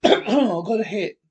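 loud, deliberate alert; urgent shout. Acted. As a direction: call it out so everyone hears. Urgent discovery in the mine.

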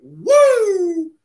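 a delighted cheer for the card; excited whoop. Real, not acted. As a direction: cheer the big pull. Cheering a rookie card hit.

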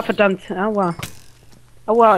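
ordinary talk, nothing marked

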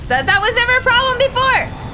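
high, loud, indignant outburst; real yelling. Real, not acted. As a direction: yell in frustration at the game. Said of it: "I don't mean to yell at you".

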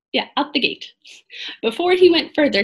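usual speaking voice

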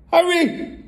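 high, panicky shriek of a mother; alarmed cry. A narrator's reading. Mother cries to her husband, panicking. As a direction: cry out in mock terror. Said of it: "cried the mother".

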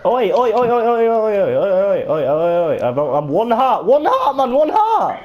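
panicked high shout for help from his mates; frantic shouting. Real, not acted. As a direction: yell for help, at one heart, climbing high at the end. Calling out in game danger.

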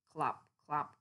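usual speaking voice

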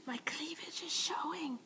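embarrassed whisper; hushed embarrassed aside. Real, not acted. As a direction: whisper it off to the side.

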